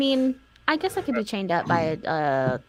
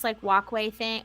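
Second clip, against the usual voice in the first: ordinary talk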